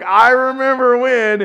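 high, loud and slow, drawn out; teasing old acquaintance. Retold or imitated. Old acquaintance teasing about the past, high and loud.

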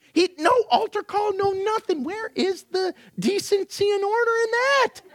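high-pitched, incredulous protest; mock outrage. Preacher jokes about a man interrupting service, mock indignation. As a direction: play the scandalized churchgoer, voice pitched right up.

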